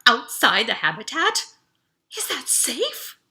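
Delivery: startled, slow and worried; alarmed. A narrator's reading. Audrey alarmed at the idea of going outside, rising fear. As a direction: voice jumps up in alarm. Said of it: "Her pupils dilated in alarm".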